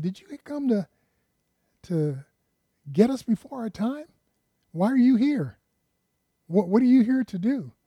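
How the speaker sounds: the demons, nervous at being caught early; frightened demons. Acted.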